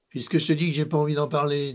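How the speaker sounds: plus fort et rapide, rugi avec colère; rugis-le, agacé; rugissement. A narrator's reading. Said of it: "dit le lion en rugissant". (le lion agacé rugit contre le narrateur)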